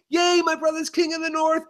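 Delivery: high, giddy mock cheer; mock delight. Voicing Sansa's first reaction to her brother as king, mock delight. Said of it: "It turned from like".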